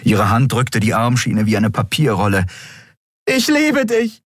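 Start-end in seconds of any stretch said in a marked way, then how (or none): 3.2-4.2 high, emotional shout; impassioned high cry; calling out. A narrator's reading. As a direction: call out your love from afar. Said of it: "rief sie".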